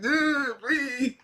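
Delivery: high pleading mock fear; imitated scared begging. Reactor voicing a scared character, high mock plea. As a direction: beg like he's terrified, but playfully. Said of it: "He's so scared".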